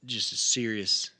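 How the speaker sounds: normal talking voice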